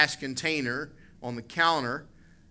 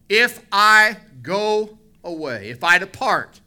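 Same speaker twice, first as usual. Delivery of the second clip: much higher and louder, fervent sermon; preaching at full force; 5 semitones higher, 9 dB louder, slower than usual. Acted. Preacher rousing the church about Jesus leaving, fervent and loud. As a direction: lift it and drive every phrase home.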